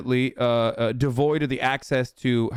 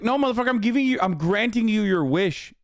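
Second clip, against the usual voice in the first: higher, sarcastic fake-generous retort; mock retort; 9 semitones higher than usual. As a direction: snap back as if granting a wish, slowing on the second line. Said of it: "It's like".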